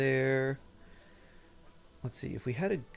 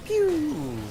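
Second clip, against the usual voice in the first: squeaky laser pew; high pew sound effect; 14 semitones higher, 6 dB louder than usual. Pretend. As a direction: zap it like a toy blaster.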